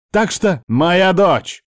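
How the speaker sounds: high, loud, drawn-out outburst; barked shout. A narrator's reading. Uncle makes the woman flinch, barking. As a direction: shout over her to stop her. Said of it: "Гаркнул гость".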